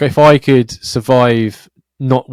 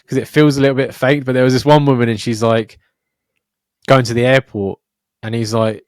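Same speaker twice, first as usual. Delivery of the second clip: ordinary talk, nothing marked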